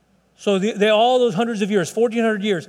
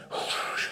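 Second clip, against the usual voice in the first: breathy whoosh of rushing water; imitated waterfall roar; 10 dB quieter than usual. Preacher recalling the sound of Niagara Falls, imitated water roar. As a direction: blow out a soft rushing whoosh.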